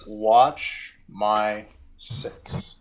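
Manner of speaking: drawn out word by word; very slow, each word set apart; slow signaling. Acted. Player signals Kaladin with a hand motion, slowly and deliberately.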